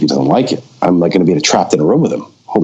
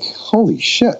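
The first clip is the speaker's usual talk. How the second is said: retold shock; slower than usual. Reacting to Roger's pushiness.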